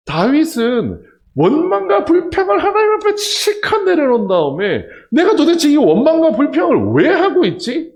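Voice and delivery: loud, high pulpit preaching; full-force preaching. Acted. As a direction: drive it home to the congregation.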